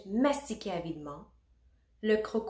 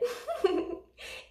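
high-pitched acted giggle; monkey giggle. A narrator's reading. Monkey at the disco party, giggling. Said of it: "le singe rigolait".

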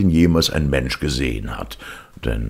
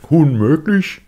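much higher, loud outraged ogre; indignant ogre; 8 dB louder, slower than usual. Acted. Ogre throws the word back at the cat, loud and high. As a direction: fling the word back in offence. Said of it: "gab der Oger zurück".

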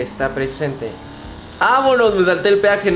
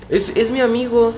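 silly voice; 9 semitones higher than usual. Pretend. Friend shown in game, joking.

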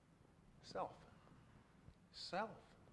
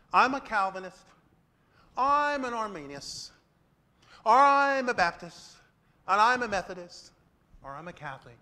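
loud, mocking list of labels; mock denominational boasting; 3 semitones higher, 11 dB louder than usual. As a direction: parody proud churchgoers naming their church.

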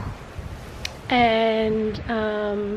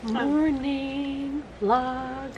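soft, hushed morning chat; quiet morning voice; 7 dB quieter than usual. Real, not acted. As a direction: talk softly so nobody wakes. Quiet morning coffee talk.

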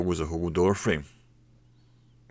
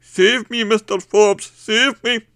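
high, loud desperate plea; cling and shriek for rescue; begging in terror; 11 dB louder, slower than usual. A narrator's reading. Barman begging Mister Forbes, high desperate shriek. Barman begs boss for help.

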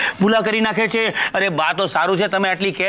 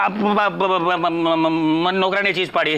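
low, slow, halting stammer; flustered, groping for words; stammering excuse; 5 semitones lower, much slower than usual. Acted. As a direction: stall on repeated syllables before the answer.

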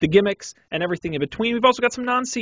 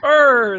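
mock shiver brr; 5 semitones higher, 6 dB louder than usual. Echoes shiver joke.

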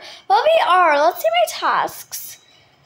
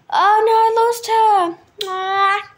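high, louder, slow wail; much higher alarmed oh no; dismayed cry; 6 semitones higher, 5 dB louder, much slower than usual. Real, not acted. Losing player in game.

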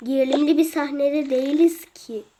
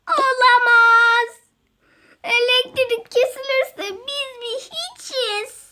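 dramatic despair; 7 semitones higher, slower than usual. Acted. Dramatic despair over blackout.

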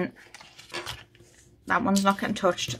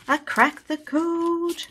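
slow, much higher, delighted cry of "code"; excited; 10 semitones higher, 3 dB louder, much slower than usual. Real, not acted. Saver announcing her win to viewers, slow excited cry. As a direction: stretch out "code" in triumph. Just cracked the savings code.

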